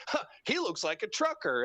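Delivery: put-on high, dismissive old-announcer voice; mocking J R. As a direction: imitate the clueless commentator. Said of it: "without skipping a beat".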